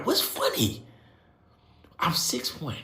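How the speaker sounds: usual speaking voice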